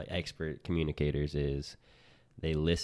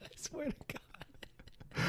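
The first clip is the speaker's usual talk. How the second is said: laughing whisper; 10 dB quieter, much slower than usual. Real, not acted. Laughing tease about accent.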